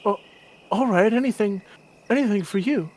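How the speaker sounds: eager youngster; eager youth. Acted.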